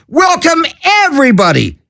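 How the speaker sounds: ordinary talk, nothing marked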